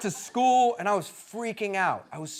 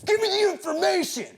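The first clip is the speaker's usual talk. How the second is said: 1 Tom Cruise yelling; 13 semitones higher than usual. Retold or imitated. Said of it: "he's like".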